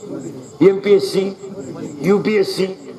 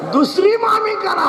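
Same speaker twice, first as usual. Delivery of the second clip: high, drawn-out punchline call; comic exhortation; 11 semitones higher than usual. Acted. Performer giving boys absurd advice about uncle, high comic exhortation. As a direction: throw the punchline out high and slow.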